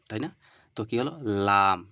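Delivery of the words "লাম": লাম said loud, tongue tip touching the upper gum; Arabic letter lam. Shown as a demonstration. Teacher modelling লাম for students, loud and clear.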